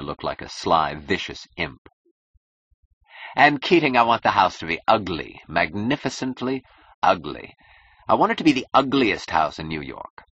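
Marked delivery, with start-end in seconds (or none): 3.3-10.2 loud, emphatic, relishing ugliness; high, forceful opening, savoured key words; imperious demand. Acted. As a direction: proclaim your perverse whim grandly. Client grandly demands an ugly house.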